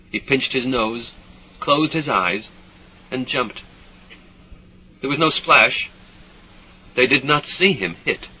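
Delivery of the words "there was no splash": usual speaking voice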